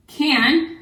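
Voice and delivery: Can said high and loud to single it out; stressed word. Shown as a demonstration. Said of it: "that word has to stay".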